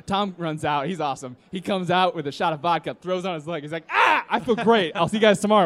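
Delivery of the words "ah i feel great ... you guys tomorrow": loud, high mock cry; acted bravado. Wounded drunk brushing off his friends, loud acted bravado. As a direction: imitate a drunk insisting all is well.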